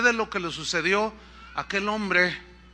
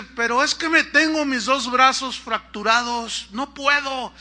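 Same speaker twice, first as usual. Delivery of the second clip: higher, louder acted protest; calling back up; 5 semitones higher, 5 dB louder than usual. Injured man to rescuer about broken arms, calling back up. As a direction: holler up helplessly as the injured climber.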